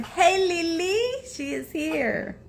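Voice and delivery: high, slow, drawn-out hello; lifted, stretched greeting; excited greeting. Real, not acted. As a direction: call out happily to a friend arriving. Greeting a viewer joining.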